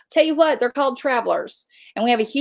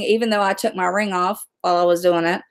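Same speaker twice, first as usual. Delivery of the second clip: ordinary delivery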